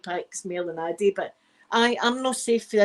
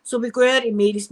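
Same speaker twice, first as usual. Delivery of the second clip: ordinary delivery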